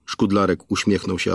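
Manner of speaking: ordinary delivery, nothing marked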